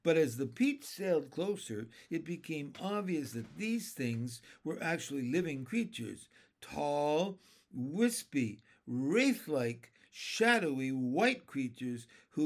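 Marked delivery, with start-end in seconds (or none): none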